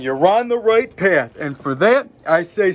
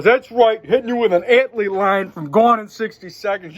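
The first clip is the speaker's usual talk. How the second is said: higher, louder boast; excited, raised-pitch brag; triumphant hype; 5 semitones higher, 4 dB louder than usual. Real, not acted. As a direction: announce your movie line proudly. Hyping a movie reference.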